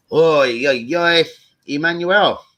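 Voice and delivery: loud greeting call. Real, not acted. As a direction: shout across to a friend. Calling out to arriving friend.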